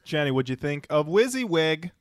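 ordinary delivery

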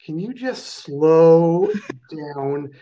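hugely stretched-out "slow down"; mock slow-motion request; imitated slow-down plea. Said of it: "I remember them saying to me".

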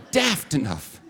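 "Daft enough" repeated in a bigger, shouted mock British accent with "Daft" falling; hammed-up British accent. Pastor repeating his British slip for laughs, hammed-up mock British accent. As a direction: ham up the British accent as a joke at yourself. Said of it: "I'm not British".

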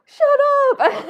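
high, loud, laughing squeal; excited shriek. Retold or imitated. Shannon shrieking back at her online friend, laughing. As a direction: shriek it with delight. Mutual recognition, both thrilled.

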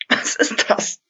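hoarse whisper after strong liquor; strained voice. Acted. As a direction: rasp it out, throat burning. After burning drink.

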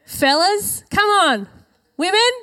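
rally the pews, fellas then women; playful calling out. Real, not acted. Preacher teasing the men and women to cheer, playful loud call.

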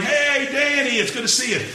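loud, high reunion hello; effusive greeting. Retold or imitated. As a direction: imitate gushing old friends, loud and high. Acted reunion greetings at the wedding.

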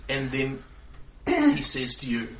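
normal talking voice

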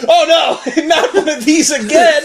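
high, laughing mock whine; a teen's high mock groan through laughter; mock dismayed kids. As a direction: imitate them groaning high. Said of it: "And they're like".

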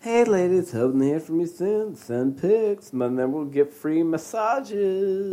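hammed-up sleazy come-on; mock sleazy guy. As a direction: read it like a smarmy creep. Reading dick pic message mockingly.

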